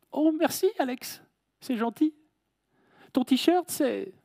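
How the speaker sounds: high, light woman's voice. Retold or imitated. Colleague replying in retold scene.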